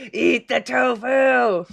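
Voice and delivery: slow evil yell; hammed-up villain shouting the command; mad scientist shout.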